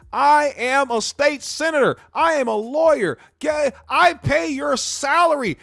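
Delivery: high, loud mock self-importance; boastful entitled citizen. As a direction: pull rank in a high, hammed-up voice. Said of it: "people that sit up there and try to talk about their accolades".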